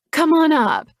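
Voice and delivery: bright high friendly call; cheery. Acted. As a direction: sing it out cheerily. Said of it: "Her cheery voice".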